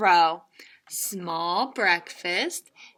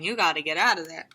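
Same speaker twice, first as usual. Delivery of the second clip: ordinary delivery, nothing marked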